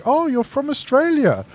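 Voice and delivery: high, eager mock tourist question; imitating a naive tourist's question; quoting a curious foreigner. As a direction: play a wide-eyed foreigner asking about kangaroos. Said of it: "someone would be like".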